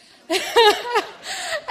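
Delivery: a high, loud, delighted laugh; real laugh breaking out high; loud burst of laughter. Real, not acted. Friend's eager reply amuses.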